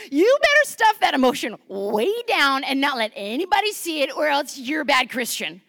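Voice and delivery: mock scolding preacher. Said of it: "he probably doesn't talk like that".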